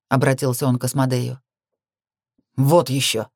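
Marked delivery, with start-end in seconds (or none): 2.6-3.3 understated fierce retort; fierce refusal. A narrator's reading. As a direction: refuse with a touch of ferocity. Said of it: "свирепо ответил тот".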